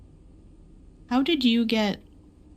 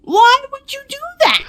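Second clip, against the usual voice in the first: upset cry; 8 dB louder than usual. Real, not acted. Friend blew up her boxes.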